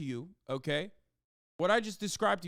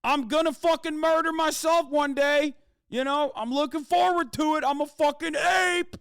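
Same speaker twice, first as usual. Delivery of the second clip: shouted, much higher, hammed-up caveman bro; mock ape-brain bro; 9 semitones higher, 8 dB louder than usual. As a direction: yell it like a gleeful idiot. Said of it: "Tell the family members of".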